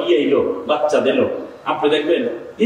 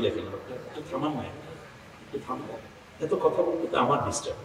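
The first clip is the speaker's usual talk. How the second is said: quiet aside; 15 dB quieter, faster than usual. Real, not acted. Asks someone off-mic to stop.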